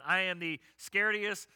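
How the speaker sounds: normal talking voice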